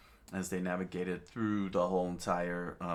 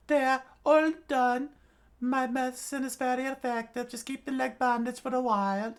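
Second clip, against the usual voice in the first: put-on old witch voice; 7 dB louder than usual. A narrator's reading. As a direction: talk like a sly old witch doctor.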